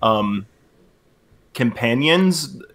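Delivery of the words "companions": "companions" drawn out as an unsure question. Acted. Fugless doubts the word for his party, slow questioning "companions". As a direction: stretch "companions" slowly, lifted like a question. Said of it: "Question mark".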